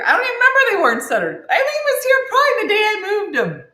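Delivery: fast, high and loud; excited. Retold or imitated. Friend's excited reply.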